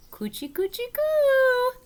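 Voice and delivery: high squeaky coochie-coo; playful baby talk. Acted. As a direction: tickle her with a silly high voice. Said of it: "I said, real fun".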